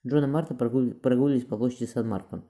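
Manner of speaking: plain reading voice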